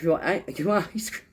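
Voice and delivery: high, fast coaxing question; coaxing aunt. Retold or imitated. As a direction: ask a toddler sweetly. Aunt asks toddler.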